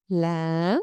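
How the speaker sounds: LA starting low, swooping up sharply; rising tone. Shown as a demonstration. Teacher models for repetition, LA rising from low. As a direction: hold LA low, then lift the end. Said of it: "start low and go up".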